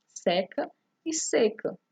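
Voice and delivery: Seca open é, then seca closed ê, slowly; contrast open and closed e. Shown as a demonstration. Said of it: "a pronúncia é diferente". (Seca com é aberto e seca com ê fechado)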